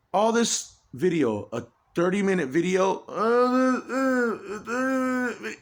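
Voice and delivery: upset, pitch climbing into strain; agitated outburst. Real, not acted. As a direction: voice rising high and cracking. Agitated rant, voice cracking.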